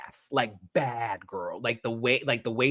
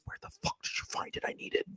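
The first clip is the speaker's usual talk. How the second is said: urgent whispered plea; 14 dB quieter, faster than usual. Real, not acted. Host begging Abor for the mug, whispered. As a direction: beg in a hush. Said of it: "whispered plea".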